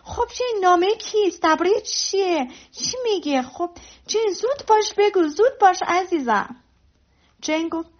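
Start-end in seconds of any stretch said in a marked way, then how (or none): 0.0-6.6 high-pitched, impatient questioning; eager excitement. Acted. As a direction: press eagerly for the news, pitched up. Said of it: "با شوق و ذوق پرسید".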